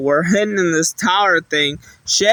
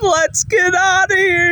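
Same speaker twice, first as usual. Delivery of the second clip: Shaggy after medicine; 11 semitones higher than usual. Retold or imitated. Shaggy bolting from the knight, high shouted yelp. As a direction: squeal it out like a cartoon coward.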